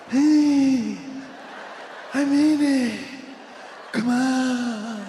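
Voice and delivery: loud speech dissolving into slurred mumbles; Joe Biden. Acted. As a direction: push it out loud, then trail into drawn-out words. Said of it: "fades into mumbles every time he gives a speech".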